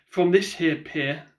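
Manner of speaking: plain reading voice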